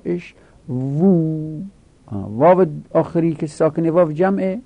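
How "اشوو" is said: اشوو said very slowly, the closing و held long and raised; slow stretched اشوو; drawn-out vowel. Shown as a demonstration. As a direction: hold the و of اشوو to show it. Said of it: "اشویا، (paradigm recited)".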